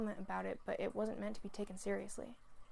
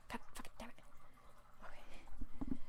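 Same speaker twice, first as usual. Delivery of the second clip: muttered curse; 3 semitones higher, 4 dB quieter than usual. Real, not acted. Reader's muttered aside.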